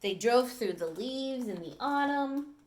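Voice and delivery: storybook read-aloud, clear and lively; reading to children. Acted. Teacher reading a dog story to the class, lively storytelling. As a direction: read it to the class like a picture book.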